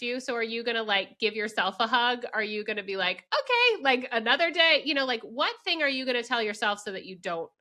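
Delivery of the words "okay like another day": lightly acted, much higher-pitched okay; breezy acceptance. Friend modelling a parent's reply to rejection, high breezy acceptance. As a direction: pop the pitch up, letting it go.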